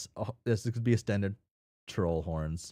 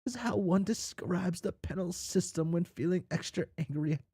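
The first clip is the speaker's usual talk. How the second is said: lilting, higher storyteller read; storytelling voice; 11 semitones higher than usual. Acted. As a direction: spin the tale like a fireside yarn. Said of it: "get back into my storytelling voice".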